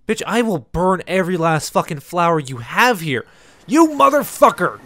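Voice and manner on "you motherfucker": high-pitched enraged scream; furious scream. Real, not acted. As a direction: scream it out at her. Screaming at the game NPC.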